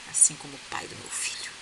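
savoring the line in a hushed whisper; whispered repeat. Shown as a demonstration. Relishing the heroine's comeback, a delighted whisper. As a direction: whisper it back like a juicy secret. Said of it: "Olha aqui".

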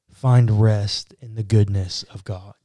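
ordinary delivery

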